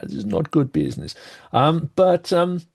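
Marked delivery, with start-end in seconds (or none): 0.0-1.1 put-on low-pitched, hammy Italian accent; terrible Italian accent. Panellist joking that turf wars hurt business, mock Italian accent. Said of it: "do my terrible Italian accent".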